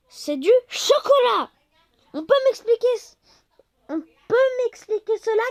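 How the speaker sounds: loud, high outraged protest; outraged yell. Real, not acted. Complaining about chocolate shinies, a loud outraged yell. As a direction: yell the question at Game Freak. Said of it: "Je vous crie dans les oreilles".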